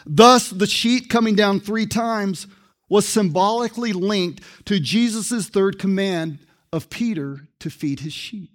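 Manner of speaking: ordinary talk, nothing marked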